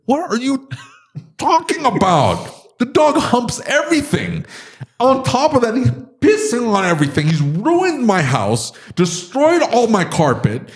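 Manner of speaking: high-pitched exasperated rant; fired up. Real, not acted. Dog owner venting about his ruined house, fired up. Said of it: "Got him fired up here".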